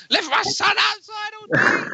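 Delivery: high, loud outraged mum shout; furious mum. Retold or imitated. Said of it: "She goes".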